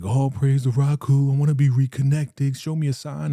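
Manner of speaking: high, loud, fervent praising; ecstatic prayer. Retold or imitated. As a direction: cry out to the heavens for a sign. Leader praying ecstatically.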